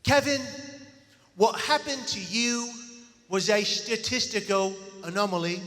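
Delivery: put-on stagey British accent with a lisp; David, British accent. Speaker imitates his doubting British friend, lisping British accent. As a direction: imitate a very British man, measured and deliberate. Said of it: "do my best impersonation of David".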